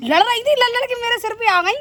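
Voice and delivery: high, loud outburst at the bird on her head; yelping up high at the bird that landed on her; startled annoyed yell. Real, not acted. Vlogger yelling at a bird that landed on her head, startled and loud.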